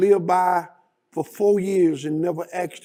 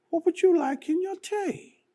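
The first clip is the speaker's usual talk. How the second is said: put-on British accent; 13 semitones higher than usual. Queen offers her visitor tea, in a high British accent. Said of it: "in her British accent".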